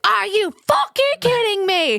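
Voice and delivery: retold scream, pitch shooting way up. Woman screams at the group when the elevator reopens, retold scream. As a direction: imitate a furious high screech. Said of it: "Starts screaming".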